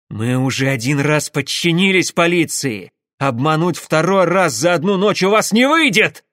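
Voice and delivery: loud, rising angry protest; furious shout. A narrator's reading. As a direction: shout defiance at the authorities. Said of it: "яростно закричал Пахомов". (яростный крик)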